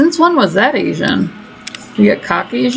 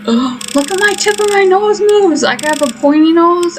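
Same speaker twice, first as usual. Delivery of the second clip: high, cutesy mock voice; 9 semitones higher than usual. Gamer voices her new character, high and cutesy. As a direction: squeal it like a pleased doll. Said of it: "Do you feel that I am also like".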